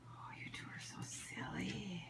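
soft whisper. Real, not acted. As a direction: whisper tenderly to the pets. Whispering to pets.

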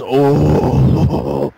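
a big loud mock crowd "Oh!"; imitating a crowd jeering a comic; crowd gasp. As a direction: play the whole room reacting at once. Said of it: "And everybody goes".

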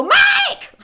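full high yell, re-enacting a call. Woman retells calling the neighbour from the basement, shouted call. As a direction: yell the name out for help. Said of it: "walk out, and go".

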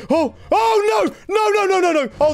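real panic, nowhere to go; panicked shout. Real, not acted. Falls into danger, shouting.

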